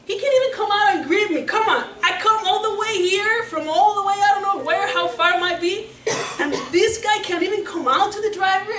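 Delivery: high, loud, fast indignant rant with laughs; barking upset. Acted. General complaining to his servants and guards, barking upset. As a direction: bark the complaints like an offended general. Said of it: "the general barking upset".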